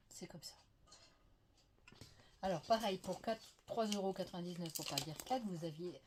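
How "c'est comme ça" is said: whispered, trailing off; whispered shrug to herself; resigned whisper. Real, not acted. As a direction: let it fade to a whisper, resigned.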